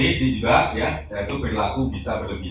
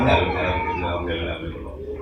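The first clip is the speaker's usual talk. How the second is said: put-on low, mumbled babbling of a toddler who can't form words; 7 semitones lower, 4 dB quieter than usual. Speaker mimics a speech-delayed child to the audience, low babbling. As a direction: mimic a child repeating one syllable, fading away. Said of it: "kalau misalnya ngomong".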